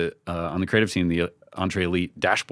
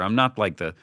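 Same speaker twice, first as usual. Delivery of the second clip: ordinary talk, nothing marked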